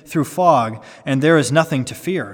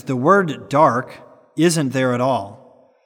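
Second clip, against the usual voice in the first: normal talking voice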